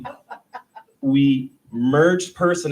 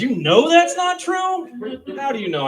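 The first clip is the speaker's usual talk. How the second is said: incredulous; 13 semitones higher, 6 dB louder than usual. Retold or imitated. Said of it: "And, like".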